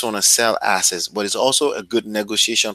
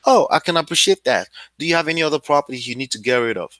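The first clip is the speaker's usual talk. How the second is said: quick breezy takeaway; faster than usual. Shown as a demonstration. Demo reply on a call.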